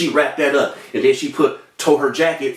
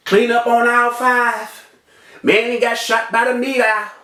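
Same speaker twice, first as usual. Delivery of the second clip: high, level mock intercom call; joking store announcement; 5 semitones higher than usual. Shot man jokes with nurse, mock store announcement. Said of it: "I'm cracking jokes".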